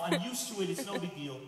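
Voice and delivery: usual speaking voice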